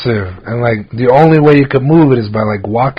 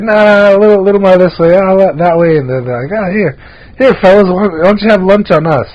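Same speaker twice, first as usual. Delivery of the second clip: higher, quick mock customer directions; imitating fussy customer; 7 semitones higher than usual. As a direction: act out the picky homeowner, then generous. Customer directing, then offering lunch.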